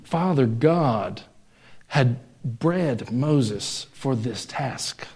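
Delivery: very slow, soft and weighty; slow solemn emphasis. Real, not acted. Preacher stressing God's plan to the congregation, slow and solemn. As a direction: lay each word down slowly.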